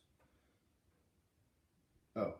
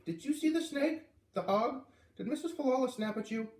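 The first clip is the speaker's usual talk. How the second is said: put-on very high, excited girl's questions; a kid bursting to know; eager girl; 13 semitones higher, 3 dB louder than usual.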